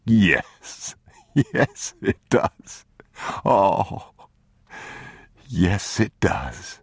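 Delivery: joyful laugh through the words. A narrator's reading. Father laughing with relief at the boy reading. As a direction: laugh with relief as you agree.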